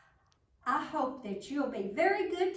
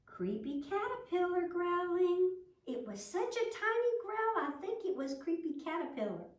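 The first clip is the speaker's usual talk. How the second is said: high teasing sing-song, much higher; mocking; 9 semitones higher than usual. Rabbit teasing the ladybug, high mocking voice. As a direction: tease her with a tiny high voice. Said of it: "Patty looked and she was sad".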